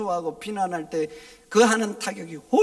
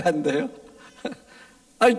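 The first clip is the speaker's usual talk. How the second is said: ordinary talk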